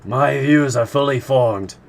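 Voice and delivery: angry harpooner retort; shooting back. A narrator's reading. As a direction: snap it back in defiance. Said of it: "Ned Land shot back".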